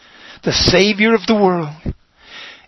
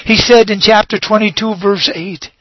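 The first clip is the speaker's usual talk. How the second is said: ordinary talk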